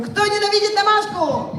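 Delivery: high-pitched hype-host question; rallying cry. Pretend. Host tries the crowd a second time, rousing cry. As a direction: pitch it right up to fire up the crowd. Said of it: "Давайте ещё раз попробуем".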